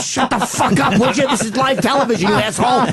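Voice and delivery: high, heated snapping back; angry retort. Retold or imitated. To Patrice at NBC, a high heated retort. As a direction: bark it back during a live broadcast.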